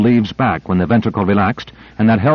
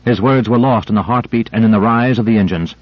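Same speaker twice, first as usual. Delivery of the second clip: ordinary delivery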